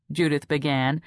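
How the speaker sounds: plain reading voice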